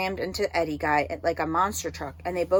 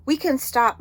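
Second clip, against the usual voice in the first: high, loud call from ahead; yelled; 8 semitones higher, 6 dB louder than usual. Acted. Miles yelling from further up the trail, loud high call. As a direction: call back down the path. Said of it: "Miles yelled from further up".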